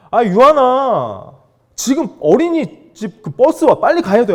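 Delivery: loud, high, drawn-out urging; exasperated parent. Retold or imitated. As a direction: hurry a dawdling child along loudly. Retold urgent father hurrying child.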